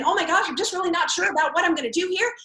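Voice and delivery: jittery excited seller talking fast; nervous excited rush. Acted. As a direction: gush it out in a nervous hurry. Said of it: "talking really fast".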